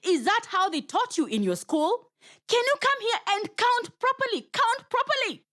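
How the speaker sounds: high, exasperated spider demanding; getting angry. Acted. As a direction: push her impatiently, voice shooting up. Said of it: "Anansi was getting angry".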